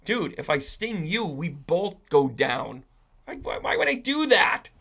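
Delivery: acted indignant scorpion, pitch leaping up at the end; persuading scorpion. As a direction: argue your innocence as the scorpion. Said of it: "And then the Scorpio said".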